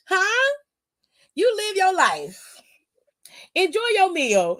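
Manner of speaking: put-on sing-song ridicule of a childish man; mocking taunt. Taunting wrestler in mock sweetness.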